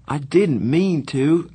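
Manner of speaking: slow, pleading apology. A narrator's reading. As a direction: beg him to understand. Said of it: "I pleaded with Darry".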